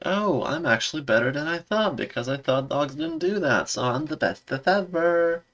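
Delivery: high, smug mocking voice; high-pitched smug know-it-all; mocking Mariner. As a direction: play her as a dopey braggart. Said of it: "then it's like".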